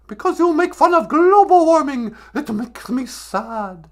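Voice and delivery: hammed-up outrage, easing off at the end; Greta Thunberg. Activist blames host for mocking global warming, mock high complaint. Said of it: "Greta Thunberg".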